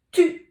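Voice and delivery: tu said high and loud; syllable reveal. Shown as a demonstration. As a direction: announce tu brightly. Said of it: "pour écrire". (tu annoncé haut et fort)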